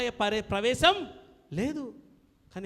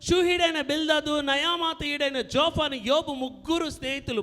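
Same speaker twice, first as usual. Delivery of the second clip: louder, higher sermon voice; full-force preaching; 5 semitones higher, 7 dB louder than usual. Acted. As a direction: drive the names home like a preacher. Preacher explains the verse loudly. (బిగ్గరగా బోధ)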